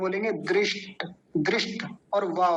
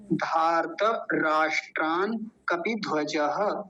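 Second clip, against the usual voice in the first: slow धार्त राष्ट्रान कपिध्वजः with breaks; slow with pauses; slower than usual. Shown as a demonstration. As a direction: say it slowly, stopping to explain. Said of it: "केवल समझने के लिए रुकेंगे". (रुक रुक कर धीमा पाठ)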